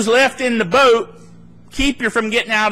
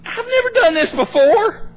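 high-pitched comic protest; mock incredulity; 13 semitones higher than usual. Peter facing a walk on water, high mock incredulity. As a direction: play it baffled, pitched way up.

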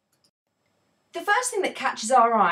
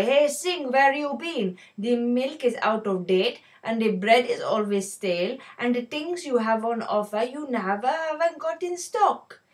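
put-on Indian accent; 3 semitones higher, 3 dB louder than usual. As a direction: shout the complaints as scornful shoppers, Indian accent. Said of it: "I'm going to have to don an Indian accent".

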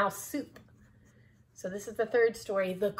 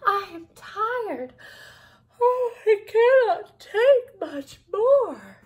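slow, high wail; high, drawn-out, overwrought complaint; exhausted, desperate mouse; 12 semitones higher, 10 dB louder, much slower than usual. A narrator's reading. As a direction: wail it out, stretching every word. Mouse at end of tether.

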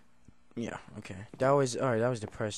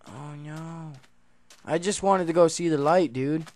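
normal talking voice

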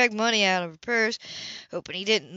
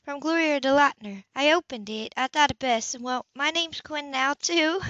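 higher woman's voice for Anna, cheerful offer; lightly voiced Anna proposing ice cream; Anna, brightly voiced; 7 semitones higher than usual. A narrator's reading. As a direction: lift into Anna, treating the kid. Anna explaining the letter.

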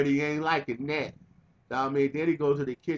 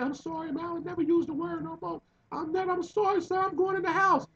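much higher, frightened pleading apology; scared begging. Retold or imitated. The thrown man begging the uncle, terrified high pleading. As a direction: squeak out the apology like a beaten man.

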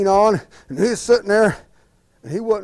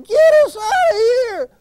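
much higher, loud, slow panicked cry; 6 dB louder, slower than usual. Acted. Crushed old couple begs to be freed, high panicked cry. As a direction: squeal it like trapped old folks.

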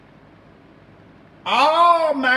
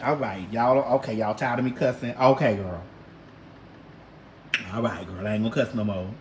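mock meek promise; 10 semitones lower, 6 dB quieter than usual. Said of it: "I was like".

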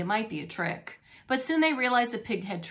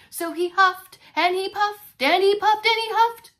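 louder and higher each time; excited build; 9 semitones higher, 10 dB louder than usual. A narrator's reading. Narrating the pig blowing hard.

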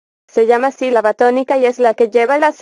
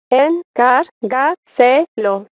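teacher breaking a word into syllables; much slower than usual. Shown as a demonstration. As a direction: separate each syllable with a small gap. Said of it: "dividir en sílabas las palabras".

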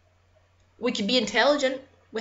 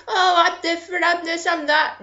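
higher-pitched mocking voice; mocking imitation; 4 semitones higher than usual. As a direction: mimic someone who thinks they're special. Mimicking a self-styled special person.

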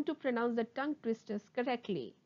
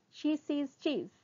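words joined in one phrase; sped-up tongue twister. Shown as a demonstration. Teacher speeds up the twister for students, briskly. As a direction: run it together briskly. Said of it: "speed up".

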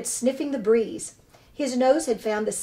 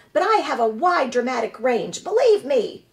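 high, showy actress voice; proud theatrical opossum; 3 semitones higher, 5 dB louder than usual. Acted. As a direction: boast like a grand stage diva. Opossum boasts of her range.

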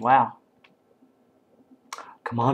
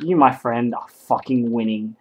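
ordinary talk

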